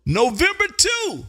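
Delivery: high, drawn-out call sign; ringing radio call. Real, not acted. Host logs the caller's sign, ringing drawn-out call. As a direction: sing out the call sign slowly.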